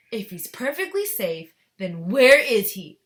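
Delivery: loud, demanding challenge; snapping. A narrator's reading. Mother demanding her son from Wonka, snapping. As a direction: snap it, bearing down hard on the question. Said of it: "snapped Missus Gloop".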